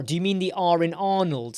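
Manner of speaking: ordinary talk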